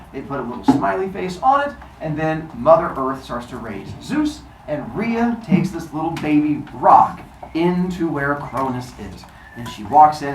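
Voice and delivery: usual speaking voice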